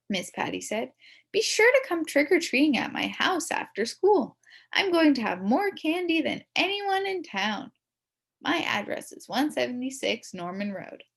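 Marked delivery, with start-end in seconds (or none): 1.3-11.0 high, bright grown-up invite; a sweet teacher inviting the kids over; lightly voiced teacher inviting kids; cheery teacher. Teacher figure voiced in story.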